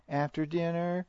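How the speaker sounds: put-on high, questioning child voice; imitating a child with a high lilt; child's reply.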